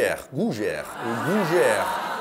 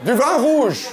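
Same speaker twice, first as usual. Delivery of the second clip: mock shout; 6 semitones higher, 4 dB louder than usual. Crowd answer repeated, shouted.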